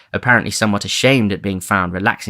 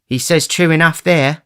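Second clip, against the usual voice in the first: put-on higher, louder young woman; Nancy; 8 semitones higher, 5 dB louder than usual. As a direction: agree quickly. Nancy voiced.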